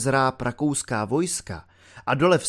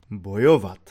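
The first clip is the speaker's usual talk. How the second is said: grave voice; 6 semitones lower, 3 dB louder than usual. A narrator's reading. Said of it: "hrobovým hlasem odpověděl Švejk".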